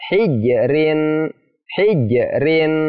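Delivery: "Hijrin" with the j bounced; qalqalah bounce. Shown as a demonstration. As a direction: bounce off the j in "Hijrin" before rin. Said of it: "dipantulkan. Cara baca seperti apa?".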